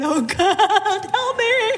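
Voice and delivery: high, laughing mock panic; panicked prayer. At the gas station, begging God for help in a high panicked squeak. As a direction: squeak it out in a comic fright.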